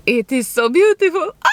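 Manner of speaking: thrilled squeal of praise; excited. Real, not acted. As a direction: gush over the pastry. Overjoyed at pastry.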